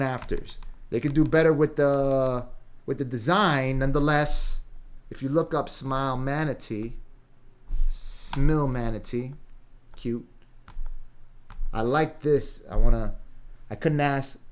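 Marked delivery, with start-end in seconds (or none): none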